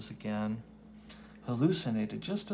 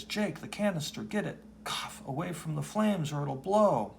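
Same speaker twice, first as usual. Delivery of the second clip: urgent warning; 6 semitones higher than usual. Acted. Truck crew warns of explosion.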